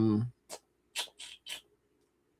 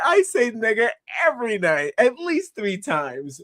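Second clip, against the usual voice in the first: mock quote; 10 semitones higher than usual. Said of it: "He said".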